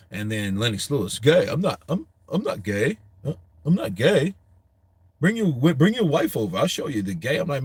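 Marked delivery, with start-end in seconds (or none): 1.2-7.4 mock indignant protest, pitch jumping high; pissed-off boxer. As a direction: act out an offended boxer's stuttering denial. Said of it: "he got pissed".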